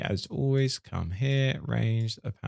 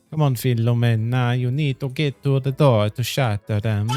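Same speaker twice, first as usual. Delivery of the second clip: louder mock Italian accent; 3 semitones higher, 5 dB louder than usual. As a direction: urge her on in a hammed-up Italian accent. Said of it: "my impression of an Italian accent".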